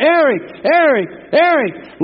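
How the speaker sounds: high, loud call for a lost son; father shouting for his missing son; hollering a name. Retold or imitated. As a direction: call out the name across the campground. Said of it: "I started hollering".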